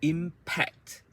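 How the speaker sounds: Impact in two strong, separate syllables, IM high; high IM, crisp strong P in Impact; word stress demo. Shown as a demonstration. Teacher models Impact so learners feel the stress, emphatic syllables. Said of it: "So the P is a strong one".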